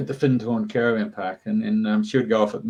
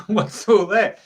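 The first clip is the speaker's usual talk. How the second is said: high, louder little pixie voice asking; curious pixie; 10 semitones higher, 6 dB louder, slower than usual. Retold or imitated. Pixie asks about kettle.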